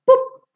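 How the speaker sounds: "pup" as a bare popped p; isolated p sound. Shown as a demonstration. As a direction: pop "pup" shortly, with no full syllable. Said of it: "cambio por el sonido".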